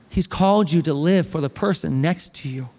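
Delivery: ordinary talk, nothing marked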